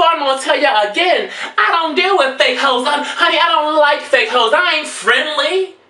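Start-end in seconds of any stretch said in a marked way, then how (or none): none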